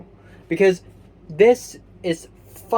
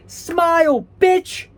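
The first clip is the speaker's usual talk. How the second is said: mock pep shout; 9 semitones higher, 3 dB louder, much slower than usual. Drama club self-pep talk.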